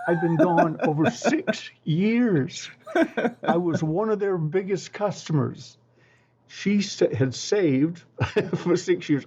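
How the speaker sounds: real laugh breaking through, slow. Real, not acted. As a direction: chuckle through the punchline. Laughing at the absurd story.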